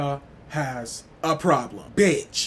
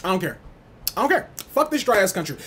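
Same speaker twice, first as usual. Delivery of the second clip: ordinary talk, nothing marked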